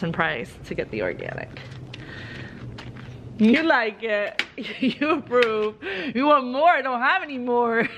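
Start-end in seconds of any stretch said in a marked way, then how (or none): 3.4-7.9 cooing to the goats over a treat. Real, not acted. Farmer cooing to her goats over bananas, sweet baby talk.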